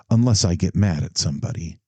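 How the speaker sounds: ordinary delivery, nothing marked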